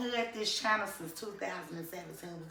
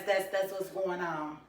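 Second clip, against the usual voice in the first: ordinary talk, nothing marked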